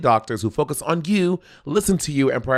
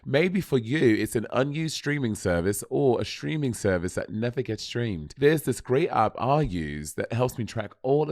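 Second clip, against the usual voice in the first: badly faked British accent, softer than usual; mock-British presenter, hushed ad read; 6 dB quieter than usual. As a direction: do a British TV show voice. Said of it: "I'll do British show".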